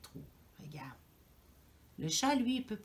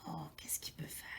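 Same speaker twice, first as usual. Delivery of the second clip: soft suspense whisper; storyteller's whisper; 3 semitones lower, 11 dB quieter than usual. A narrator's reading. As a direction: hush it like a secret. Suspense aside to children.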